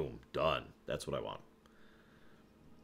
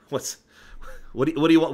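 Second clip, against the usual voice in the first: usual speaking voice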